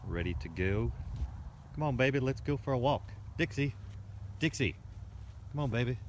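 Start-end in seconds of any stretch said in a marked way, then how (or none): 1.7-6.0 calling the dog. Real, not acted.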